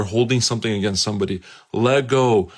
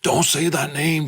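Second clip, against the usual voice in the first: higher put-on demon voice, pleading; the demon warns the preacher off, pitched up; 6 semitones higher than usual. Demon inside the man refusing the name of Jesus, higher voice. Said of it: "the demon starts speaking".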